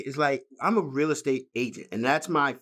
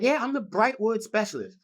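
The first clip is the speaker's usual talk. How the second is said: imitated boastful agent; 8 semitones higher than usual. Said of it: "She was always like".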